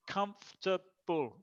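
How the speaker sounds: "Comfortable" slowly, as comf-ta-ble; syllable by syllable. Shown as a demonstration. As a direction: break "Comfortable" into three beats. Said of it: "three syllables".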